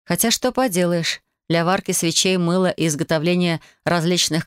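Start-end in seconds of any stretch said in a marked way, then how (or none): none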